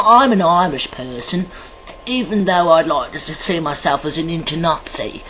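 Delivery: usual speaking voice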